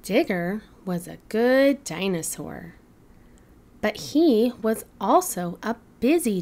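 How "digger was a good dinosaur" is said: ordinary delivery, nothing marked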